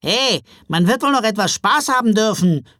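loud, high-pitched grumbling imp; aggrieved little elf; complaining. A narrator's reading. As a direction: protest loudly, put out. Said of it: "beschwerte sich ein Weihnachtswichtel".

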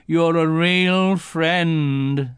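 very slow, heavy thanks; mournful Eeyore. A narrator's reading. Eeyore thanking Pooh, slow and mournful. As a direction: draw it out gloomily.

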